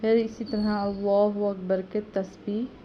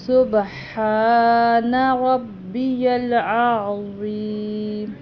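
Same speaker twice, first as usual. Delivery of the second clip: slow, drawn-out chanted prayer, louder; prayer recitation; 2 semitones higher, 8 dB louder, much slower than usual. Shown as a demonstration. Teacher demonstrating ruku tasbih, slow louder chant. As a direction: recite it slowly and fully from the throat.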